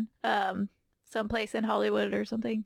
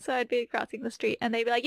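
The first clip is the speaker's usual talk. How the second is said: ordinary delivery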